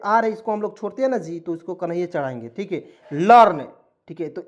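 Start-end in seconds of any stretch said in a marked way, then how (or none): 3.1-3.7 learn said loud and slow as "larn"; stressed word. Shown as a demonstration. Teacher drilling the word for students, learn loud and slow. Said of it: "L E A R N, learn".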